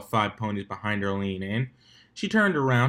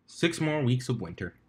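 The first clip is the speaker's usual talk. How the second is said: ordinary delivery, nothing marked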